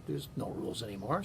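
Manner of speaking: usual speaking voice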